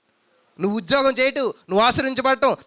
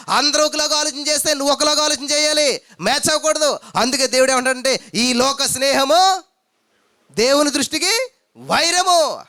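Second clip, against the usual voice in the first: raised fervent preaching; preaching at full force; 5 semitones higher, 5 dB louder than usual. Acted. Preacher rousing the congregation, fervent and raised. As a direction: drive the point home to the church.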